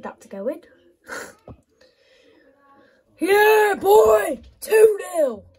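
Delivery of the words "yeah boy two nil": much louder, higher shout; triumphant yell. Real, not acted. Gamer yelling over his second goal, loud. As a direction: roar the celebration.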